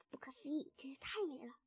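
soft, half-whispered awe; hushed wonder. A narrator's reading. Fox marvels between swigs, hushed. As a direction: marvel under your breath.

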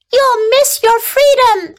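high, urgent goose call; honking goose. A narrator's reading. Goose warns the pig leaving, honking. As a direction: honk a warning in a high voice. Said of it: "honked the goose".

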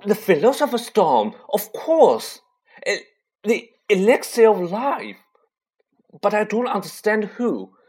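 high, loud rush of alarm; excited realisation. Acted. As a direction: blurt it out in sudden shock.

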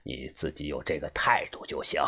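soft chuckle, then very low voice; boss's approving laugh. A narrator's reading. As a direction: chuckle and accept the apology deeply, quietly. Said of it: "他笑了". (把头轻笑后低沉地认可)

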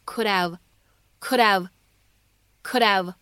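"could have" linked with a silent h; "could have" run together as could-ave; dropped H. Shown as a demonstration. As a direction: glide through "could have" with no h, d into the vowel. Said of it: "我们可以做一个击穿的现象".

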